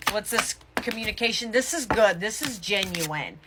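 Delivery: ordinary delivery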